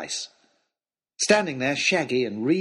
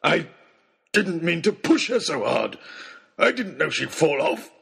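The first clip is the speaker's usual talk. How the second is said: rough, raised-pitch excuse; shouted protest; 4 semitones higher than usual. A narrator's reading. Oxshott excuses pushing her, shouting. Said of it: "shouted Oxshott".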